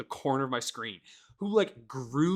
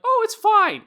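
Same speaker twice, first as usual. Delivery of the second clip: mocking quote; 9 dB louder than usual. Mocking people who defend game.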